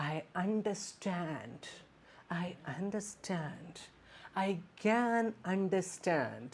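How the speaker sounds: slow, soft and soothing, like comforting someone; gently reassure, drawing the words out; soft empathetic tone. Shown as a demonstration. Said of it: "Then what you can say like".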